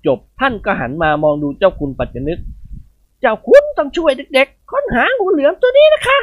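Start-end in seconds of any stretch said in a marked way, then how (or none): 2.8-6.2 put-on high, commanding old lady's voice; a matron rallying every servant to hunt the snake; bossy old lady. Khun Ying Wad ordering the servants to kill the python, high and loud. She asks Chao Khun.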